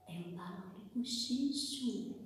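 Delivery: very soft demonstrative whisper; hushed, barely-there whisper. Shown as a demonstration. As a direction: whisper it like a secret to the class. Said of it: "falando bem baixinho, sussurrando".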